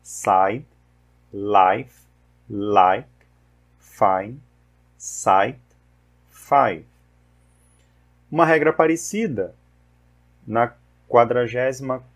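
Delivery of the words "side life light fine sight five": "Side", "life", "five" with the vowel of "eye"; teacher modelling the long I in "light" and "fine"; English long I words. Shown as a demonstration. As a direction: model each word slowly, saying the I like "eye". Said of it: "Mais uma vez".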